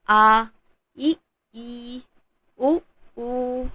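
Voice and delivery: आ इ ई उ ऊ spoken one by one, clearly; vowel demo. Shown as a demonstration. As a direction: model the vowel pairs short and long. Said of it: "तो यहाँ पर जो जैसा है". (मात्राओं का उच्चारण)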